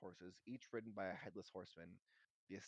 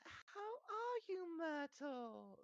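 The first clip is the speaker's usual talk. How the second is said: slow, very high fake cheer; falsely bright; 4 dB louder, much slower than usual. A narrator's reading. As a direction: overdo the friendliness, high and drawn out. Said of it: "in a falsely bright voice".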